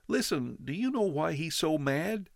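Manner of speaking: put-on high, boyish protest; boy questions the father, upset; young boy. A narrator's reading. Boy asks neighbor.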